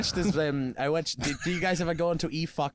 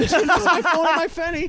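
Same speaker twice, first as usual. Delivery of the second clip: loud, high mock Scottish shriek; screaming Scottish lady; 10 semitones higher, 5 dB louder than usual. Friend retelling a woman's panic, loud Scottish accent. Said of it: "she starts screaming".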